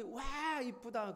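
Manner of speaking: high excited coo, stretched out; whole family gushing at something pretty. Retold or imitated. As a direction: mimic the family's delighted wow.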